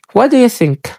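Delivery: "do you" slurred into "daya" in "What do you think?"; quick, linked "wadaya think"; native reduction. Shown as a demonstration. Teacher models the native version again. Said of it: "What daya".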